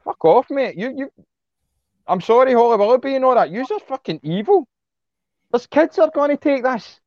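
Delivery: high, heated accusation; furious at the TV celebrities; angry rant. Real, not acted. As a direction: call them out with rage. Ranting at celebrities about kids.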